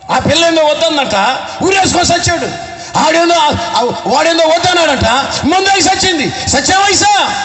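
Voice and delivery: put-on fiery sermon shouting at wasted young lives; impassioned preaching. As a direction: rail at the congregation from the pulpit. Preaching at full force.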